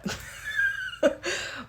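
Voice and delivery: high burst of laughter; big laugh. Real, not acted. Laughing at her doctor's joke, a big high laugh.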